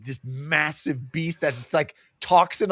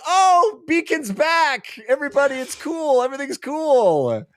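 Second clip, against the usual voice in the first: high, loud, falsely relieved announcement; mock relieved cheer; 12 semitones higher, 3 dB louder than usual. Wife announcing her husband is back, high and giddy. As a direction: cheerfully wave everyone down, fooled.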